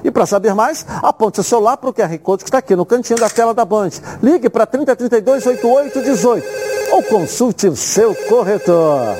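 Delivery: upbeat TV sponsor read; hard sell ad. Acted. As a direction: sell it like a live TV ad. Host reads sponsor merchandising.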